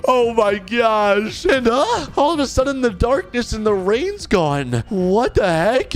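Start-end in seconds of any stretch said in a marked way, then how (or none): none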